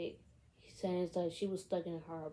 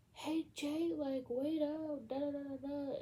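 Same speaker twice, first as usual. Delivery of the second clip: mock calling out; 9 semitones higher than usual. To Jay who is far ahead, mock calling out. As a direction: imitate calling after someone without raising the volume.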